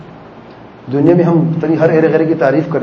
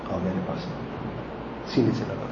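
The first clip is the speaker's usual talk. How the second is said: tender embrace; 7 semitones lower, 15 dB quieter than usual. Pretend. Father embracing the ashamed child.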